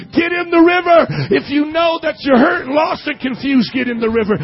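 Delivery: loud, high preaching shout; preaching at full force. Real, not acted. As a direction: build it up and call it out to the church.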